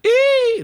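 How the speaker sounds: very high, loud "eee!"; sudden full-voiced high shriek as a demo; demonstrated shout. Shown as a demonstration. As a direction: belt out a piercing squeal. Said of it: "everything was whispering. Like, I didn't do".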